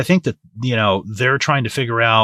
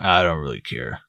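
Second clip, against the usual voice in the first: mock indifference; 7 semitones lower, 5 dB quieter than usual. Mocking Freddie's fake indifference.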